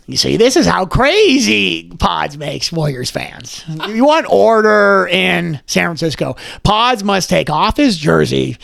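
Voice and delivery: slow, theatrical villain voice; Joker-style spoof. Acted. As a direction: deliver it like a Joker monologue about Pods. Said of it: "Let me do a Joker video".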